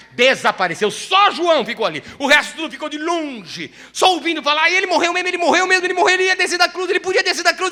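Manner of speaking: loud, high, driving preacher's cry; fiery preaching. Acted. Preacher stirring the congregation about the cross, fiery. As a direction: build it up and hammer it home. Preaching crucification at full force.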